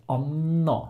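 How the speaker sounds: "Anna" with the n held on, short first A; long N. Shown as a demonstration. Teacher showing elongated consonants, long n in "Anna". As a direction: linger on the n of "Anna". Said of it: "we have a long N".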